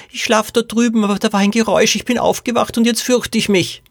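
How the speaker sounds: put-on high, whining little-girl voice; Poppy. A narrator's reading. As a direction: whimper it like a frightened small child. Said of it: "jammerte Poppy". (hohes, jammerndes Kleinmädchenstimmchen)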